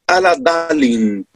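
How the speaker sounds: "ala dalin" said slowly with Arabic sounds; slow Arabic-style "ala dalin"; Arabic recitation. Shown as a demonstration. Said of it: "el final de la Fatiha".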